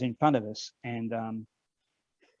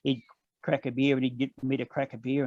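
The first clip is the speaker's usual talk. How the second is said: ordinary talk, nothing marked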